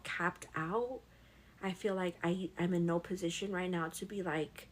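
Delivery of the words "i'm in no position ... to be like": normal talking voice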